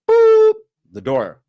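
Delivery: high, loud, slow "boop" for the door; door sound effect. Retold or imitated. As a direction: mimic the door popping open. Imitating the cell door opening.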